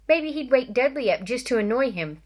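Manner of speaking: ordinary delivery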